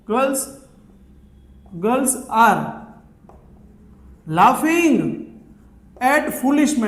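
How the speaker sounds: slow, with long pauses between words; spaced-out teaching delivery; word-by-word modelling. Shown as a demonstration. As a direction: dictate it piece by piece to learners. Teacher builds the sentence word by word.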